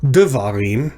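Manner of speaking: "devarim" with the stress on the first syllable, de; wrong first-syllable stress. Shown as a demonstration. Teacher showing students a mistaken stress, "devarim" stressed on DE. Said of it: "So it's not devarim".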